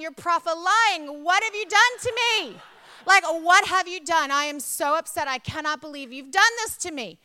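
re-enacted outrage at her husband, loud and high; furious confrontation. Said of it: "am furious. I'm mad. I'm angry".

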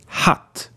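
hatt with a quick open 'a'; short A. Shown as a demonstration. Teacher asking learners to repeat, short A in hatt. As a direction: model hatt with its vowel cut short. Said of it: "a short one".

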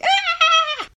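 put-on very high, loud toddler scream; frantic toddler yell. A narrator's reading. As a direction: screech like a toddler fighting the seat. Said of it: "yelling frantically".

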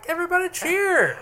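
high shouted cheer; rallying cheer. Real, not acted. Co-host rallies friends after one admits getting drunk, rallying cheer. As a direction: call out high to rally everyone.

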